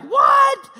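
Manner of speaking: very high, loud shouted what; mock bewildered yelp; baffled what. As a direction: shriek it in confusion. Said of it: "they're like, not getting it".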